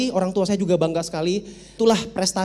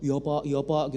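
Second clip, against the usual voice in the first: put-on timid kid; 5 semitones lower, 6 dB quieter, slower than usual. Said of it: "kan biasanya anak-anak takut".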